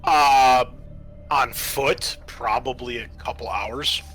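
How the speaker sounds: ordinary talk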